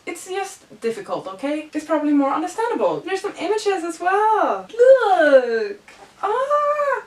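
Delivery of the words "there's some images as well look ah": high, loud thrilled gasp; excited. Real, not acted. Flipping through the pages, she squeals with excitement. Finds pictures inside book.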